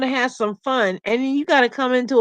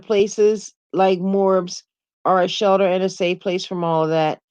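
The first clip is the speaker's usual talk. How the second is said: ordinary talk, nothing marked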